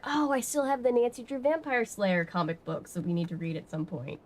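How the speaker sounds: normal talking voice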